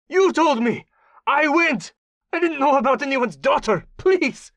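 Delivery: high, loud panicked pleading; desperate begging. Acted. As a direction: scream it out, begging for mercy. Tortured captive begs desperately.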